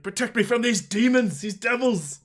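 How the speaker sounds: high, frantic fleeing plea; panicked prayer. Acted. Fleeing priest begs protection from the party, high and panicked. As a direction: pray in terror while running.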